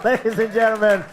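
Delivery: loud, high emcee build-up; announcer hype. Acted. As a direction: pump up the crowd and bring him on. Host introduces next act.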